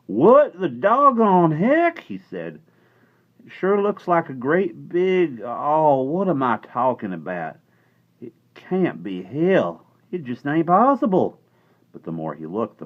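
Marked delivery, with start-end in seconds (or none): 0.1-2.0 high, loud, slow cowboy drawl; a stunned little cowboy gaping; astonished cowboy. A narrator's reading. Cowboy staring up, amazed, in giant hands. 3.4-11.4 high, loud, slow cowboy voice; disbelieving cowboy. A narrator's reading. Cowboy refusing to believe where he is.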